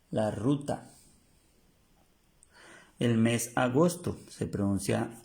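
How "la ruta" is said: ordinary talk, nothing marked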